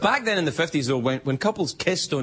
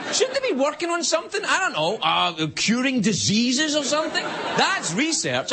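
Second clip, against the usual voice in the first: much higher, indignant disbelief; incredulous; 10 semitones higher than usual. Real, not acted. Host rants to audience in high-pitched disbelief.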